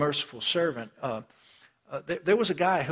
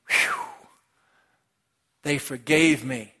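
relieved whew; 4 dB louder, slower than usual. Acted. Relieved debtor voiced.

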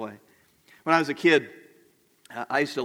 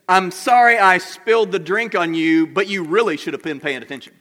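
a loud, defensive fake apology; mock blaming apology; 3 semitones higher, 7 dB louder than usual. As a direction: say sorry, then lightly shift the blame. Example of a blaming non-apology.

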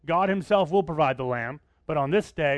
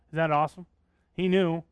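usual speaking voice

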